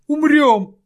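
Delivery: very high, loud shriek; panicked shriek. Acted. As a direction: scream the word in panic. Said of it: "тараторил он".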